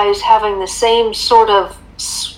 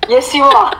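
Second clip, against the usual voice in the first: usual speaking voice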